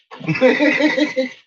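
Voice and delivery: loud high burst of laughter. Real, not acted. Owner laughs at dumb dogs, high burst.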